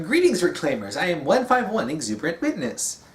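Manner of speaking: Exuberant Witness. Retold or imitated. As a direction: announce yourself as a high-pitched AI. Voices monitor introducing herself when woken.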